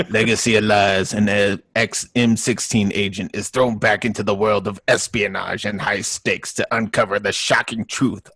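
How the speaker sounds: dramatic movie trailer narrator, laughing at the start; trailer voiceover. Pretend. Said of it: "Take two".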